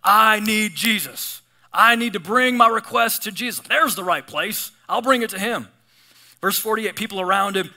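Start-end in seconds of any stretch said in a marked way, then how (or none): 0.0-5.7 loud, slow, half-sung plea; loud, half-sung cry to Jesus. Retold or imitated. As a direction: belt it out like a desperate beggar. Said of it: "He's getting loud about it".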